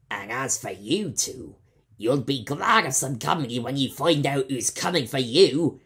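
taunting pirate. Acted. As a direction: gloat at the prisoners.

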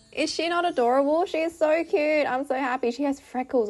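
high, loud, bubbling excitement; delighted gushing. Real, not acted. Gushing over adorable new villager.

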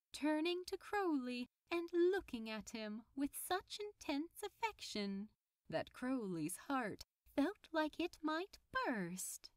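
usual speaking voice

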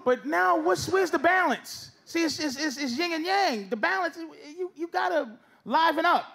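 ordinary delivery

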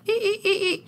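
high playful nudging squeak. Retold or imitated.

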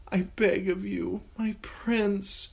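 put-on high, pleading girl's voice; terrified girl begging her prince; frightened begging. A narrator's reading. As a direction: plead desperately. Sansa begs Joffrey.

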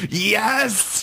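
high, gleeful mock cheer; excited fan cheer. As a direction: cheer like a fan at a midnight premiere. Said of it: "just going".

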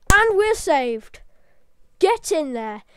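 loud, drawn-out cry of relief; jubilant cheer. Real, not acted. Gamer cheering as the craft is saved, jubilant. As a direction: celebrate a narrow save, loudly.

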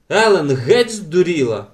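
high, loud outburst; exclaiming. A narrator's reading. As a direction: call it out in exasperation. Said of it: "вигукнула Беата".

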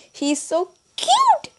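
high, drawn-out adoring squeal; fangirl gushing. Real, not acted. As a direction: gush in a stretched, high-pitched squeal. Gushing over favorite band member.

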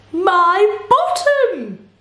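high, drawn-out cry of dismay; alarmed cry. A narrator's reading. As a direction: cry out in shock, stretched long. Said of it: "cried Bernard".

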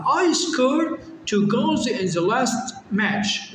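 very slow, word by word; slow model reading. Shown as a demonstration. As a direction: spell the sentence out for the class. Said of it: "reads the sentence".

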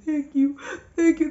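tearful thanks. Retold or imitated. Hugging friends, overwhelmed.

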